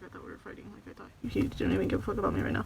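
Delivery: hushed whisper, telling a friend a secret; whispered worry. Retold or imitated. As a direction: whisper it like a confession. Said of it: "I was like".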